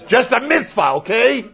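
high-pitched insistent mother; mock motherly shout. Mother with no verse to prove her point, high mock shouting. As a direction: push it high, putting authority behind a demand. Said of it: "she would shout at you".

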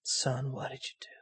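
hushed, broken-hearted fatherly whisper; a father whispering to his dead son; grieving father whisper. Retold or imitated.

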